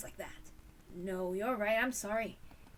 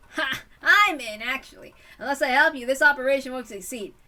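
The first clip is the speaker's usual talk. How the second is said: bold declaration; 3 semitones higher, 12 dB louder than usual. Acted. Bold volunteering.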